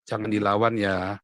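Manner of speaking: low, slow fatherly caution; imitated father's counsel. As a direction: voice a father calmly holding his child back. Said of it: "ayah saya selalu bilang". (menirukan nasihat ayah, rendah dan pelan)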